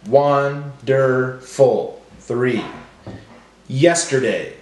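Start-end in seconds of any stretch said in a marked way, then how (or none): 0.1-2.0 won... der... ful, very slow; broken into syllables. Shown as a demonstration. Teacher breaking up words for class, slowly syllable by syllable. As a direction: draw out each syllable separately. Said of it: "break up our words into syllables".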